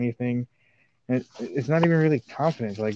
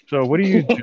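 interviewer voice; 4 semitones higher, 6 dB louder than usual. Acted. Acted interviewer question.

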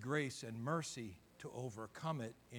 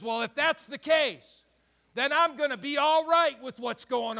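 loud, high-pitched preaching; 12 semitones higher, 13 dB louder than usual. Acted. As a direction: build the fire in the pulpit. Preaching at full force.

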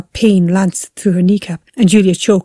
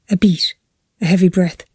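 ordinary delivery

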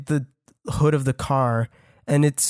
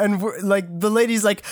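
normal talking voice

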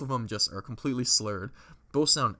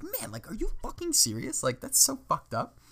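high-pitched mock disbelief; indignant protest; 11 semitones higher than usual. Drunk friend protests to the barman who rejected them, indignant. Group protests being kicked out.

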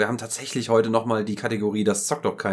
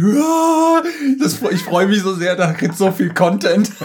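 put-on high, loud, giddy child voice; excited little kid; 9 semitones higher, 7 dB louder, slower than usual. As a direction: squeal it like an overjoyed kid. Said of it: "wie so ein kleines Kind".

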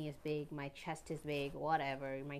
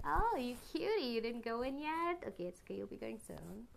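cooing to a pimple; 5 semitones higher than usual. Pretend. Talking sweetly to a pimple.

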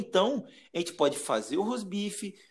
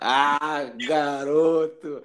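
excited exclamation; 7 dB louder, much slower than usual. Acted. Cook enters with big show.

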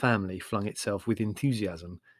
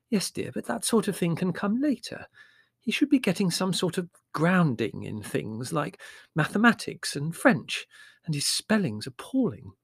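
high-pitched motherly voice; Mother, mildly fretting; 7 semitones higher than usual. Acted. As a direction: gently put the children off, pitched up.

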